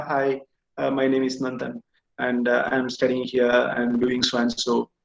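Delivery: flat, low and soft, sitting slumped; low-energy answer. Shown as a demonstration. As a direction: mumble it like a bored candidate. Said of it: "simply sitting like this and you are telling that".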